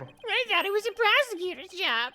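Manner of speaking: very high, mocking voice; mocking squeaky parrot voice. Mimics in a high voice.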